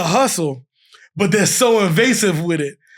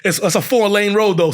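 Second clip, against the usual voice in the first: normal talking voice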